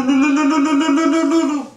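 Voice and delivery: very high, loud trilling lu lu lu; high ululation. Shown as a demonstration. Teacher demonstrating women's high wedding ululation, very high and loud. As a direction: ululate with the highest voice you have. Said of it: "an element that you do lu".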